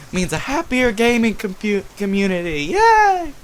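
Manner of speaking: high, bright mock cheer rising to a squeal; excited. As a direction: end on a high little cheer.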